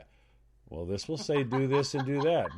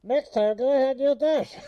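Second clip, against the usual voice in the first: raised mock speaker-box voice; voice through a cup; 9 semitones higher, 3 dB louder than usual. Co-host coaching the menu man, high cup-intercom voice. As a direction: demonstrate the garbled intercom sound. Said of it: "hand him a cup and go".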